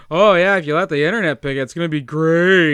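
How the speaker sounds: eye-rolling sarcasm. Shown as a demonstration. [name] shows Brendan how he really says it, sarcastic eye-roll. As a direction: roll your eyes and rattle it off. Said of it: "a lot of eye rolling that goes along with it".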